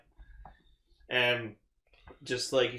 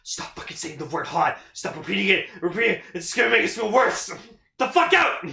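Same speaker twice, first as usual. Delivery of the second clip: exasperated rant building; 6 semitones higher, 5 dB louder than usual. Retold or imitated. Fed-up captain scolding crew.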